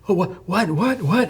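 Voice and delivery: high, rising panicked what; retelling a friend's sudden panic; panicked disbelief. Retold or imitated. Shot friend realizing he is hit, panicked rising voice.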